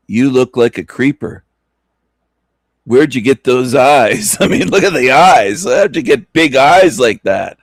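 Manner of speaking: loud mocking jeers; loud taunting. Real, not acted. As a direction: jeer at him loudly, teasing. Taunting the man on video.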